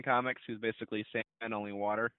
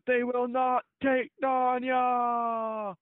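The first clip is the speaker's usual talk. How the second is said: very slow, much higher, louder mock war cry; drawn-out trailer shout; 13 semitones higher, 5 dB louder, much slower than usual. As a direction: drag it out like the original trailer line. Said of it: "the way it was originally".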